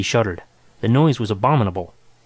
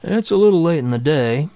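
drawled; 3 semitones higher than usual. A narrator's reading. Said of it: "he drawled".

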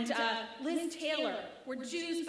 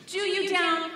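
loud angry echo; 4 semitones higher, 9 dB louder than usual. Acted.

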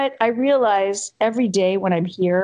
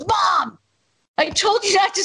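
high-pitched retold outburst at mom; acted-out daughterly protest, pitched way up; exasperated scolding; 12 semitones higher than usual. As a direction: scold your mother in mock exasperation. Acting out scolding her mother.

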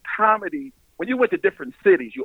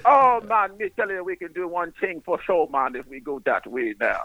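mock Jamaican accent; 2 semitones higher than usual. Shown as a demonstration. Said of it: "If you really want to speak Jamaican".

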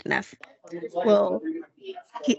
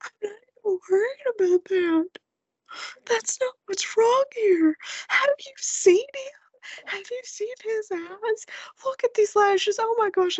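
very high, drawn-out mock sobbing; imitated crying; 12 semitones higher than usual. Mom weeping over son's eyes to husband, imitated wailing. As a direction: gush tearfully, as if overwhelmed. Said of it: "and I'm crying".

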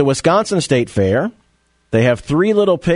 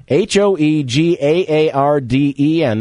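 ordinary talk, nothing marked

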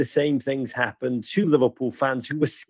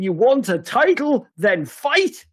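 put-on manic German-accented shout, pitched higher; hammed-up German accent, yelling a challenge; Klopp accent; 8 semitones higher than usual. Pundit doing Klopp's German accent, screaming maniacally. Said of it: "That was my Klopp accent".

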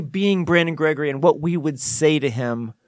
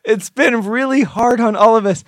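embattled coach; 5 semitones higher, 5 dB louder than usual. Acted. Plays embattled coach denying a killing.

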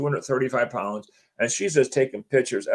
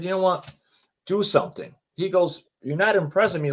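higher-pitched mock challenge; retold challenge; 5 semitones higher than usual. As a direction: dare it, pitch raised but not shouting. Joe challenging the entity, retold.

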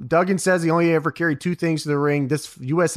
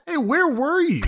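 mock high question; 11 semitones higher than usual. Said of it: "asks Nash".

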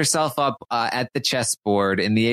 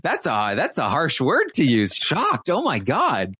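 mock offended outrage; 4 semitones higher than usual. Retelling Brandy's outraged reply.

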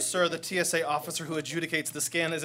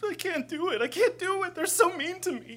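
whimpering; 12 semitones higher than usual. Acted. Officer breaks down whining.

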